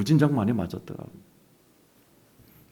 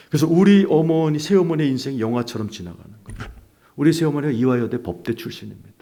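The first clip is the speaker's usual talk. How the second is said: normal talking voice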